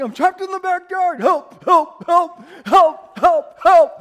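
high, shouted barks as words; trapped dog. Acted. Dog trapped in the backyard, frantic mock cries for help. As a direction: yelp each word like a barking dog. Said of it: "what's that dog saying anyway?".

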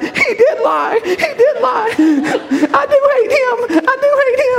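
whimper-laugh like a broken recruit. Retold or imitated.

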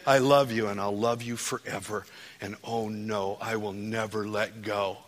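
ordinary delivery

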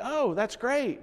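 high-pitched imitation of awkward politeness; awkward approval. Friend unsure what to say to the pastor, imitated awkward approval. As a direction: act out being lost for a reply. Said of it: "not really kinda knowing what to say".

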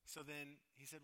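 ordinary talk, nothing marked

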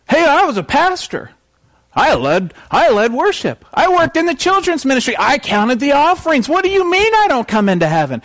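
high-pitched, hammed-up pleading; indignant protest. Preacher acting church people turned away from heaven, indignant protest.

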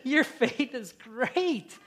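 slow, fond lines with a chuckle; Jesus delighted, speaking through a laugh. Retold or imitated. Jesus laughing warmly to the woman, slow and fond. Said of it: "and he's laughing".

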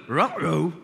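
goofy cartoon-dog "uh-oh"; youth pastor's silly voice. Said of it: "he'd go".

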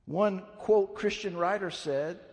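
ordinary delivery

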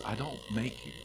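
softly invite, never pushing; gentle soft voice. Acted. Preacher voicing God's gentle call, fading very soft. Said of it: "But he says".

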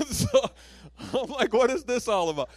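high startled exclamation. Retold or imitated. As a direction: throw it up in disbelief. Shocked at public prophecy.

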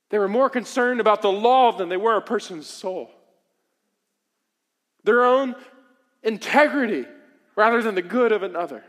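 loud, fervent sermon delivery; rising passionate preacher; preaching. Acted. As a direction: preach it with full force. Preaching at full force.

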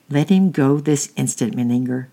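plain reading voice

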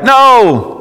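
high, drawn-out yelled reply; yelling back. Acted. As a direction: holler it back across the water. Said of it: "They yelled back".

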